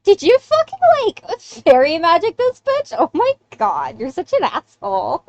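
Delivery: high-pitched, incredulous teasing; drawn-out, amused scolding; laughing mockery. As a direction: tease him through amused disbelief, voice leaping high. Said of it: "just kinda laughs and goes".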